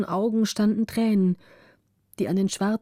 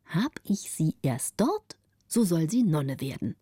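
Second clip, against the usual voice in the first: scheming inner thought; 3 semitones lower than usual. A narrator's reading. Countess secretly planning to make her a nun, scheming. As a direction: plot it to yourself, measured and deliberate. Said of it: "Aber die Gräfin dachte".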